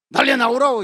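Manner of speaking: high, loud command to get out; executioner's shouted order; barked order. Retold or imitated. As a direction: shout the prisoners out.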